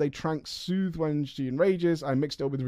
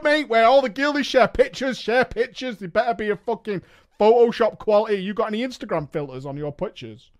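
ordinary delivery

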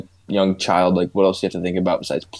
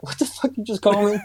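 much higher mock outrage; mock offended; 12 semitones higher than usual. Uncle retelling his mock offense at the niece. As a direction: pretend indignation, hammed up. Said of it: "And I was like".

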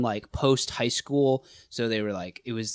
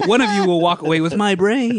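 louder, playful grand judge; a hint of a theatrical judge; mock game show judge; 7 semitones higher, 4 dB louder than usual. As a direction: proclaim it like a game show host. Hams being the judge.

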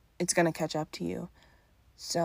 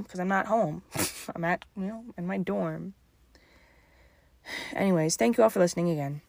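ordinary talk

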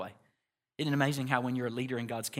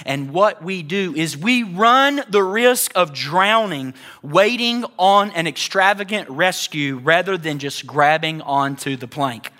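slow emphatic preaching; 4 semitones higher, 13 dB louder, slower than usual. Acted.

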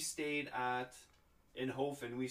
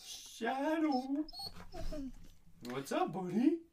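high-pitched sing-out to a pet; calling the dog; 13 semitones higher, 3 dB louder, slower than usual. Real, not acted. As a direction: coo loudly and high to your dog. Calling to the dog mid-recording.

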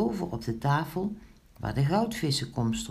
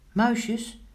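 high-pitched summons; calling out; 8 semitones higher, 3 dB louder than usual. A narrator's reading. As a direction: call the little helpers over. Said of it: "riep Pinkeltje". (hoog roepend)

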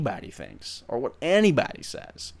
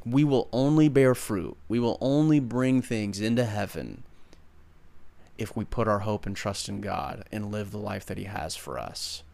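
ordinary talk, nothing marked

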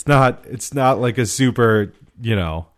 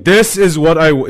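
mock insistent explaining; 6 semitones higher, 6 dB louder than usual. Acted showing drawing to bakery worker.

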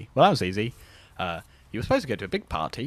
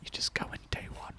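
breathy whisper; 13 dB quieter than usual. Real, not acted. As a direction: whisper it like an aside. Whispered aside.

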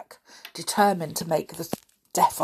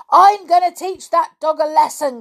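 high, loud, furious cry; in a fury; 11 semitones higher, 11 dB louder than usual. A narrator's reading. Caretaker threatening to teach the dog, furious. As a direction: cry out in an old man's fury. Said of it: "cried the old fellow in a fury".

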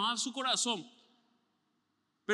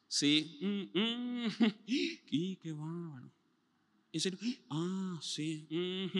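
mock bored interest; 4 dB quieter, much slower than usual. Bored listener faking interest in the talk, soft and slow. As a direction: feign interest half-heartedly.

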